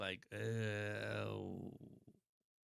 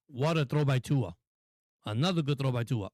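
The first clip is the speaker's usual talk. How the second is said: usual speaking voice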